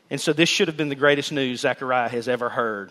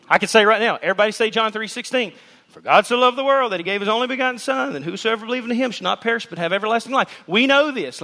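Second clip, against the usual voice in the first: raised, fast preaching voice; 7 semitones higher, faster than usual. Acted. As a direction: lift the congregation up.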